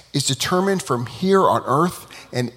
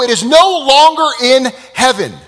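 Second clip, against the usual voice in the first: louder, higher, proclaiming; defiant declaration; 9 semitones higher, 9 dB louder than usual. Acted. Students quoting Torah at God, defiant. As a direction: throw the verse back at heaven.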